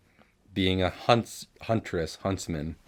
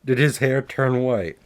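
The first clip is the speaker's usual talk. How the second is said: higher, louder laughing question; 6 semitones higher, 9 dB louder than usual. Real, not acted. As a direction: squeal the question through laughter. Laughing while confused.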